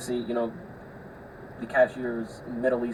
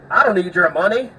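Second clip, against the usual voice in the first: hostile snap; 6 semitones higher, 9 dB louder than usual. Retold or imitated. Hostile redneck voiced in story.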